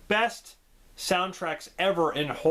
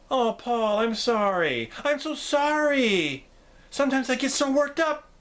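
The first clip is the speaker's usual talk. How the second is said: lightly higher, sugary sorry; a hint of the nurse turning sweet; mock apology; 8 semitones higher than usual. Nurse apologising to Paul, lightly higher mock-sweet.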